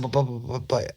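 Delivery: imitated high-pitched stammering; retold excited stuttering. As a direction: stumble over the word, bursting to get it out. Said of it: "he, all stuttering. He's so excited".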